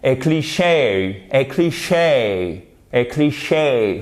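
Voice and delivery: slow cliché, English vowels, stress on the last syllable; cliché drawn out the English way. Shown as a demonstration. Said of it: "Faut vraiment le prononcer comme ça". (cliché lentement, à l'anglaise)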